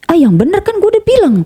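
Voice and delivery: high-pitched mock reproach; retold exasperated disbelief. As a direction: act out a friend's high, incredulous telling-off. Friend reacts to the overpayment.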